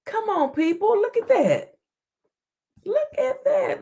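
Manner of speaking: high-pitched outraged "look at that"; exasperated exclaim. Real, not acted. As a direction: throw your voice up in disbelief. Reacting to the judge news.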